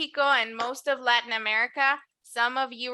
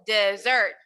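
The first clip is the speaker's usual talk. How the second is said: dessert with SERT stressed and falling; stress on the last syllable; 3 semitones lower than usual. Shown as a demonstration. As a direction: land on the end of dessert. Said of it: "Aquí el énfasis está en la última".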